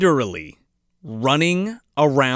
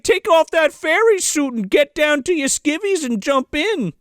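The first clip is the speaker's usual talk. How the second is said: high-pitched jolly urging; mock Santa; 10 semitones higher than usual. Santa urging the fairy into water, high mock cheer. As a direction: coax in a raised cartoon voice. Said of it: "He's like".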